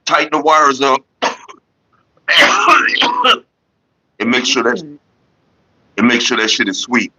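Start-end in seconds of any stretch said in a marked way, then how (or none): 2.3-3.4 harsh coughing after a hit; coughing fit. Real, not acted. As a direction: cough hard from smoke.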